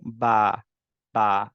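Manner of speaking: বা with the tongue opened upward. Shown as a demonstration. Teacher demonstrating the open vowel in বা. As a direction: say বা open and clear. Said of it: "উপরের দিকে টাংটাকে open করব".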